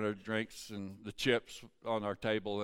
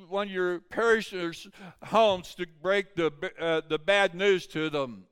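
high, raised sermon voice; preaching at full force; 6 semitones higher, 6 dB louder than usual. Real, not acted. Preacher tells of Peter and John at the temple, forceful preaching. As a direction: drive the Bible story home to the congregation.

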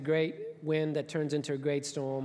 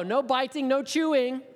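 higher, louder, stern orders; firm command; 7 semitones higher, 4 dB louder than usual. Acted. God warns the fish not to bite, loud and stern. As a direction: lay down the rules firmly.

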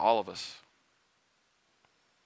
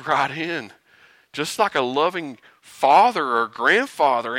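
ordinary talk, nothing marked

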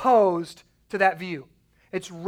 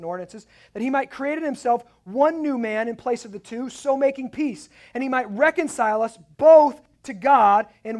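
lightly raised, declaiming Bible reading; scripture reading; 5 semitones higher, 3 dB louder than usual. Acted. Preacher reading Ephesians to his congregation, lightly raised. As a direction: read the passage aloud to the church.

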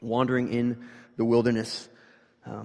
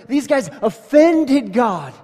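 emphatic shout; 9 semitones higher, 10 dB louder, much slower than usual. Real, not acted. Preacher builds to a shout.

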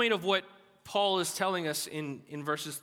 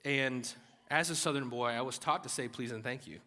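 ordinary talk

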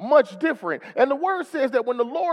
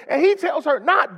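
ordinary talk

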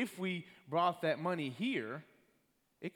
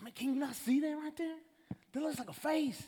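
insistent; 12 semitones higher than usual. Retold or imitated. Telling his buddy at work he sees a face, high and insistent. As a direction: make him see it, pitched way up.

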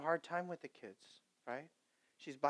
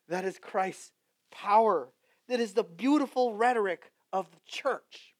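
full-force preaching; 4 semitones higher, 12 dB louder than usual. Acted. Pastor crying out to his congregation, loud and high. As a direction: preach it to the rafters.

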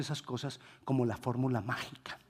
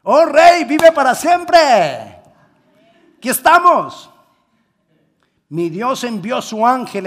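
much higher and louder, ringing proclamation; triumphant shout; 8 semitones higher, 14 dB louder than usual. Acted. Daniel calling up to the king, triumphant shout. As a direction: shout it out in victory.

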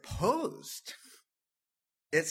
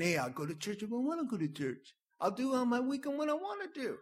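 fast, high, brash mock voice; stubborn flesh; 8 semitones higher, much faster than usual. Pastor voicing the flesh's excuses, fast and brash. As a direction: rattle it off like a spoiled rebel.

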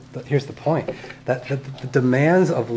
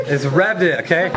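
usual speaking voice

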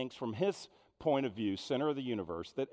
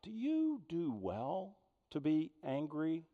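tender question from God; 5 semitones higher than usual. Acted. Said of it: "the Lord said".

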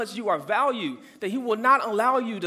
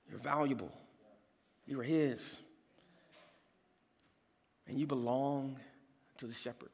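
much softer, lower sincere reassurance; tender quiet appeal; 7 semitones lower, 11 dB quieter than usual. Real, not acted. Pastor softly assures the congregation they are loved, tender hush. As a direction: lean in and speak softly to each listener.

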